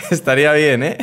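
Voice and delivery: high laughing giggle through words. Real, not acted. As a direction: laugh while you say it. Laughing at his own joke. (riéndose a carcajadas)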